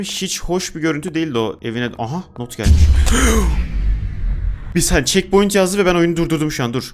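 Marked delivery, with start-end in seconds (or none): 2.9-4.7 quick high airy gasp of surprise; startled gasp. Real, not acted. Player surprised by a new note, short gasp. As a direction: catch your breath in shock.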